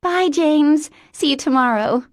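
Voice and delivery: put-on high, bright-eyed childlike voice; an eager little girl in a grown woman; childlike Mary Elizabeth.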